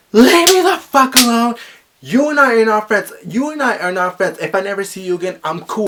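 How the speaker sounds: high, loud fed-up yell; retold angry yell. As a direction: shove him off with your voice. Yelling at stalker, breaking point.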